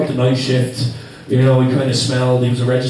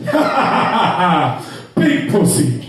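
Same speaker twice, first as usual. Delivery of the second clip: [name]'s mock laugh; 2 semitones higher, slower than usual. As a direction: laugh it out like a big Romanian guy.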